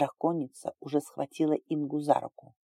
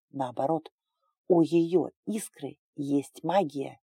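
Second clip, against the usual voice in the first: plain reading voice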